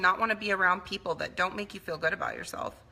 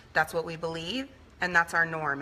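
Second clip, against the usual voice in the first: usual speaking voice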